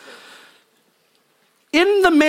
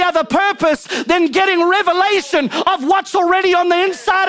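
high-pitched, driving sermon delivery; fervent preaching; 6 semitones higher than usual. Real, not acted. Preaches at full force.

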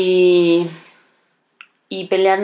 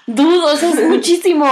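higher and louder, incredulous; mock exasperation; 8 semitones higher, 6 dB louder than usual. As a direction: scold a friend in disbelief. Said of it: "Y yo".

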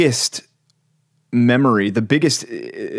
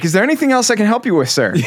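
mock-helpful, much higher and quick; smug agent; 11 semitones higher, faster than usual. Bank agent to caller who erred, smugly helpful. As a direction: imitate a smug customer service rep in a raised, hurried lilt. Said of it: "Like, just that smugness".

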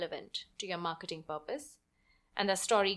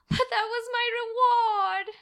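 put-on high, tearful child's wail; a little girl on the verge of tears; sobbing; 14 semitones higher, 6 dB louder, faster than usual. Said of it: "she sobbed".

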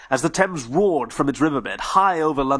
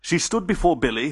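ordinary delivery, nothing marked

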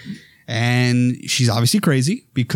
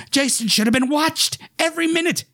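high, stern scolding voice; sharp, aggrieved mother's complaint; Mrs Voorhees scolding. Retold or imitated. Mother blaming the counselors, high and stern. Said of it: "great lines".

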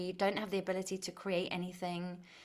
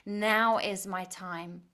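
slow, louder, resolute affirmation; determined declaration; 5 dB louder, much slower than usual. Acted. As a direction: declare it firmly, word by word. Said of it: "as you roll your sleeves up".

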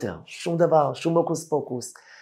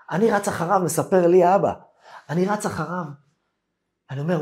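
ordinary delivery

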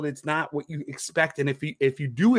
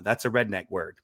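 normal talking voice

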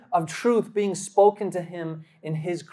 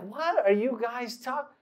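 higher, soft imitation of a confused woman; puzzled questioning; 4 semitones higher, 8 dB quieter than usual. Girlfriend cuts in on the two friends, imitated soft puzzlement. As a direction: ask it slowly, quietly baffled. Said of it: "furling her brows".

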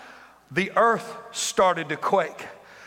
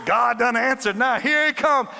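high loud whoop into eager cry; fired up; 7 semitones higher, 5 dB louder, slower than usual. Acted. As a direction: hype yourself up. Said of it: "Elijah was going".

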